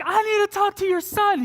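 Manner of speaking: very high mock female voice; imitated insistent demand. Ex demands to see him.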